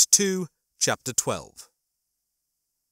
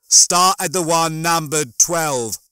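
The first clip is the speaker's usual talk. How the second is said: loud, slow, raised instruction; yelling; 4 semitones higher, 7 dB louder, slower than usual. A narrator's reading. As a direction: yell it slowly and distinctly over the din. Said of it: "Holly was yelling".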